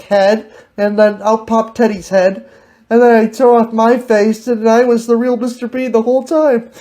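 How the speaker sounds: normal talking voice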